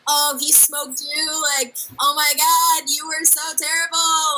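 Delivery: high, slow mocking sneer. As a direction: sneer like a snide commenter. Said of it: "people who are negative saying".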